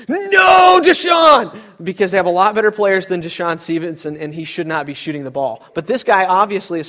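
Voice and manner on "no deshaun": high, loud fan shout of dismay; crowd yelling. Retold or imitated. Preacher voicing Mavericks fans groaning at a bad shooter, loud shout. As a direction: yell it like the whole arena groaning. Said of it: "all of them went".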